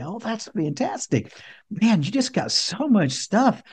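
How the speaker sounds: high, slow, soft amazement; dad cooing to child. Retold or imitated. Dad praising his son's armful of gifts, cooing. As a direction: marvel softly for a little boy, drawn out. Father praises smiling small son.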